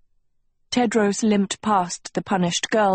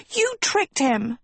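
high, drawn-out accusation; accusing shriek; 7 semitones higher than usual. A narrator's reading. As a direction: shriek it slowly, without real volume. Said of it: "Sophie shrieked".

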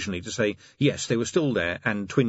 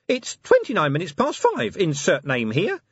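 high small nervous voice; a jittery little imp; 10 semitones higher, 5 dB louder than usual. Acted. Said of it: "said the imp nervously".

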